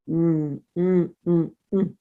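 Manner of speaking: delighted hums over a mouthful of honey; yummy mm sounds. Acted. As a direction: bear savouring honey, mm mm. Bear tasting honey.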